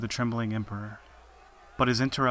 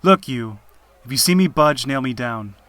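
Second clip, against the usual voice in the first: yelling out; 2 semitones higher, 12 dB louder than usual. A narrator's reading. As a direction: yell the order at your captors. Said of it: "but he yells out".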